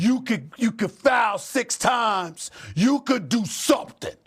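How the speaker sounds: high, stretched-out emphasis; emphatic and worked up; exasperated insistence. Real, not acted. Host exasperated, listing what player could do.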